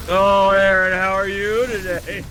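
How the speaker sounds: loud, slow creepy greeting. Shown as a demonstration. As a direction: drag it out, creepy and unsettling. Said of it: "Everything is in creepy Paco voice".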